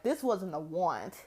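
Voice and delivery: ordinary talk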